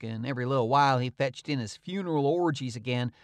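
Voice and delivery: ordinary delivery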